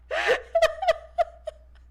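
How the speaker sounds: loud squealing cackle at her own choice; high shrieking laugh. Real, not acted. Gamer cracking up at a flirty option, squealing laugh.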